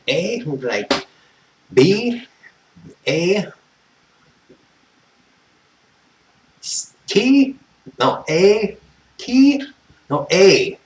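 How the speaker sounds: loud, high, spelled-out yelling; shouting letters. Retold or imitated. Shouting VIN letters at a confused phone agent. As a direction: bark each letter down the phone line. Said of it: "Scream the fucking thing out at him".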